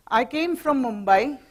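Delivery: ordinary talk, nothing marked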